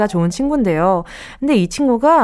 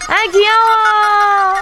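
cooing; 10 semitones higher, 4 dB louder, much slower than usual. Real, not acted. Cooing over the dolphin.

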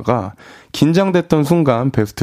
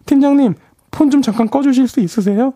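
panicked plea; 14 semitones higher than usual. Acted. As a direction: beg in a flustered rush.

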